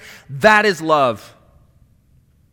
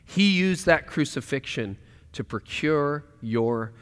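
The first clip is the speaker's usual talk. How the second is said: tender voice of God; 8 semitones lower, 5 dB quieter than usual. Acted. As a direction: speak as a patient, loving Father. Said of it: "He says".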